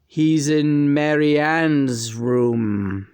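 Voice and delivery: very slow, deliberate spirit voice; John's channeled voice. Retold or imitated. Channeling John through her, a slow, deliberate voice. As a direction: let another voice speak through you, slowly. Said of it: "John's voice comes through my mouth".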